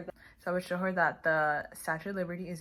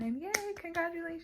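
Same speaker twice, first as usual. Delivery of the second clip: much higher, excited yay; excited cheer; 12 semitones higher than usual. Shown as a demonstration. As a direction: squeal with joy for the child. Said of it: "expression and excitement".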